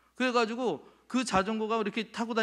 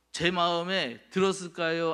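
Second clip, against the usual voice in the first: usual speaking voice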